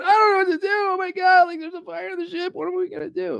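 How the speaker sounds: high, frantic mock panic; shrill pretend freak-out about the fire; mock panicking. Panicking husband on burning ship to his wife, hammed-up high panic. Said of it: "if you're freaking out, going".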